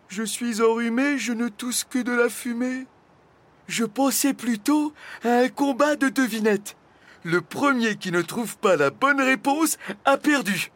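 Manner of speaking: high-voiced dragon making excuses, then proposing; sheepish dragon, explaining. A narrator's reading. (dragon penaud qui se justifie, voix haute)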